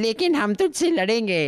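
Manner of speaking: mock kids' voice. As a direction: squeak it out like kids vowing to fight. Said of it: "And then another voice comes".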